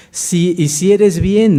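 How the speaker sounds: forceful preaching. Acted. Repeats God's promise forcefully.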